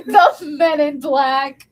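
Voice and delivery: loud, slow, laughing echo; amused echo. Real, not acted. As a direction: draw it out loudly through laughter. Amused, echoing the co-host's joke.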